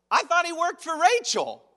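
hammed-up outraged surprise; mock disbelief. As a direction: act out the shock of the swap. Mock outrage at Laban's trick.

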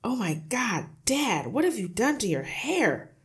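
high, loud, swooping disbelief; son's appalled outcry; shocked shout. A narrator's reading. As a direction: shout at your dad, aghast. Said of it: "the son shouted".

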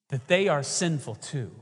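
ordinary talk

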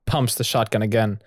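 usual speaking voice

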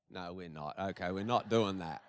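low, hushed retraction; quickly taking it back under the voice; quiet aside. Real, not acted. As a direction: drop it to a low, soft aside. Retracting his joke about roll call.

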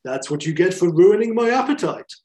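hammy villain taunt; villain line. Retold or imitated. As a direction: snarl it like a gloating monster. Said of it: "And he says".

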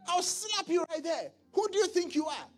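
high, loud bullying threats; arrogant rich man. Retold or imitated. Preacher acting a rich man threatening the poor. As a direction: slap him down with your voice, high and loud.

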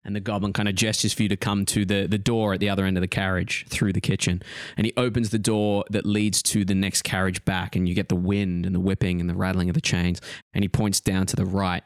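ordinary talk, nothing marked

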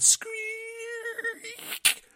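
very high, drawn-out screeching squeak; shrill sustained squeak; long squeak. Acted. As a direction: imitate a creaky step with a piercing squeal. Last name is a squeak.

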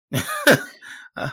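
sudden high-pitched laugh. Real, not acted. Football fan reacting to goals scored against Arsenal, high laugh. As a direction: burst into a short, high laugh.

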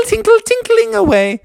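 high bell-like chant; sing-song it high like a tiny bell; tinkling away. A narrator's reading. Narrator following Tinkerbell escaping Froggy, high tinkling voice.

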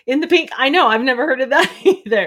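fast giggly talk through laughter; laughing. Real, not acted. Host laughing to viewers, fast and giggly. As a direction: laugh while you admit it.

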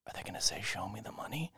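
barely audible, voiceless wondering; secretive whisper. Acted. Hostage on the floor thinking aloud, whispering. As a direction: whisper it to yourself. Hostage murmuring to himself, acted.

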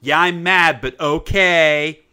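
mock-sulky agreement, drawn out; grudging okay. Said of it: "she's all like".

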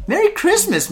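sing-song high Merry Christmas; high cheery greeting. Acted. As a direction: chirp it out playfully.

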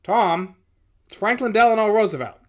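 loud, high, grand declaration; presidential hail. A narrator's reading. President introducing himself to the supervisor, calling out. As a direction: call out your name grandly. President calls through the door.

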